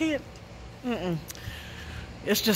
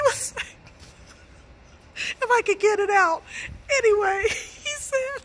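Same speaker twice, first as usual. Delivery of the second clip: laughing; 10 semitones higher than usual. Real, not acted. Laughing too hard to go on.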